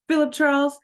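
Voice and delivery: high name, slow firm command; angry demand. A narrator's reading. Minnie demands Philip take her home, angry. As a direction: insist on going home now. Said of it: "she demanded".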